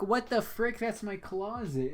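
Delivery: high, shocked outburst; surprised. Retold or imitated. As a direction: act baffled and alarmed. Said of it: "I'm like".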